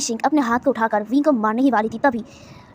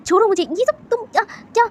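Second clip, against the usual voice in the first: much higher cry of pain; pained yell; 8 semitones higher, 3 dB louder than usual. A narrator's reading. Ishing screams at Wangji to let go, pained high cry. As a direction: scream his name in pain and panic. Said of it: "ईशिंग दर्द में चिल्लाते हुए कहती है".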